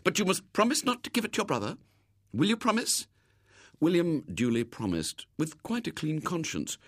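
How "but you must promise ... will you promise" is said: high, quick lady's voice; generous lady. A narrator's reading. Lady sets a condition on her gift, kindly insistent. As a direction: ask for a promise, pitched well up. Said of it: "she said generously".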